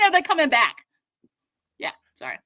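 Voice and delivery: ordinary talk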